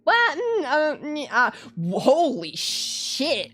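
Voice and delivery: high, loud shocked what. Real, not acted. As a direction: caught completely off guard. Shocked by the game's turn.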